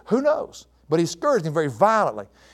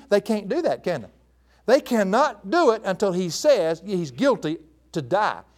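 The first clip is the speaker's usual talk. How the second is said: ordinary talk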